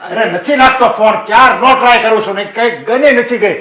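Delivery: loud, exasperated outburst about the phone; angry father. Acted. Worried father yells at his family, loud and angry. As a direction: snaps in frustration that the phone won't connect. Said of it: "એટલો ગુસ્સો શું કામ કરો છો?".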